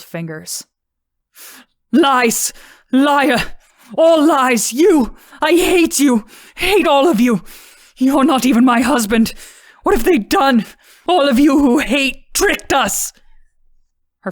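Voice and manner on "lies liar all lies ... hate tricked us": high, loud, frantic screaming rant; hysterical screaming. Acted. Mother screaming at husband and daughter in hysterics. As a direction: scream it out, out of control. Mother raging, held back.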